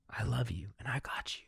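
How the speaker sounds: soft whispered comfort; tender whisper. Retold or imitated. Comforting the little girl version of her, whispered. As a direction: whisper it to a small child.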